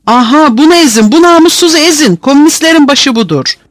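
high, urgent incitement; vicious egging-on. A narrator's reading. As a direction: point out the culprit and urge them on. Prisoner points out leader to attackers.

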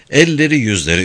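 plain reading voice

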